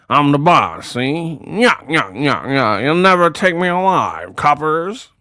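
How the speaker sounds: lightly voiced 1930s mobster growl; a mock gangster voice imitating the baby; old Chicago gangster. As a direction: toss off a hint of Al Capone tough guy. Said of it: "talking like a old Chicago gangster".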